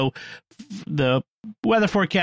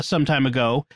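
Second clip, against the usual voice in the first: usual speaking voice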